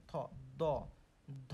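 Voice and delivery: থ, দ, ধ each said alone with the tongue tip on the teeth; separated dental sounds থ দ ধ, tongue to teeth; demonstrating sounds. Shown as a demonstration. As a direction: set out থ, দ, ধ one by one as a teacher's dental demo. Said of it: "দেখুন আমরা উচ্চারণ করি কি?".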